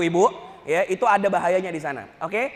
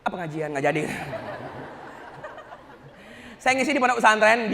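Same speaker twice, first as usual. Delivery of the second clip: usual speaking voice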